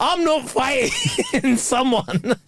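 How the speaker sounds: drawn-out refusal broken by laughter; laughing protest. Real, not acted. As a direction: crack up halfway through, stretching it out. Laughing through a mock-indignant rant.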